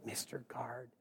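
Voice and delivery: breathy whisper close to the ear; a flight attendant whispering his name. Retold or imitated. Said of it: "she goes".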